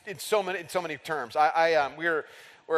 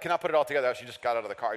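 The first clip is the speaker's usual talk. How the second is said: usual speaking voice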